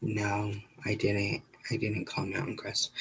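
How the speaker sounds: hushed, understated retold denial; quiet denial. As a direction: drop to a soft, level voice. Partner pretending to be her, denying.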